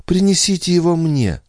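very high, thin plea; faint broken plea. A narrator's reading. Weakened Andrea asking for her baby, faint and high. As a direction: beg weakly with pitch held high. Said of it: "еле внятным прерывающимся голосом прошептала Андреа".

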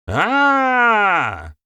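long, loud held "aaah" yell; sustained attacking scream; battle yell. A narrator's reading. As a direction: yell out as you lunge. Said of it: "выкрикнул старейшина".

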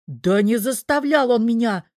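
exasperated outburst, high and loud; angry yell. A narrator's reading. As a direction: yell it, truly fed up. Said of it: "заорал чёрт".

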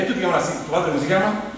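normal talking voice

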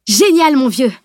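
loud, high cry of enthusiasm; exclaiming, delighted. A narrator's reading. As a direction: burst out cheering a friend's idea. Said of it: "s'écria Salim".

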